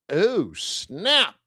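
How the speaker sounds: a high, playful "oh snap" with a pitch jump; mock surprise. Commentator to audience, realizing Iran supplies China: mock surprised. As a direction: land the punchline with jokey alarm. Punchline after naming Iran.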